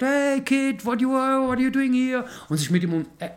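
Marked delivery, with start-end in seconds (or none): 0.0-2.2 much higher, bright voice; a star charming a little kid. Retold or imitated. [name] greeting young [name] at the airport, high mock voice. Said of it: "und er sagt".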